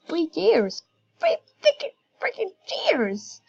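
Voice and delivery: high-pitched, whiny mock despair; mock anguish. Player lamenting the three-year wait for a new land, mock anguish. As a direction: voice leaping up as if about to cry. Acted outrage at long wait.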